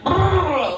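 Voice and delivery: high rolled purr; sexy purr. Retold or imitated. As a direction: purr it out like a flirt. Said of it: "no one in a different country is like".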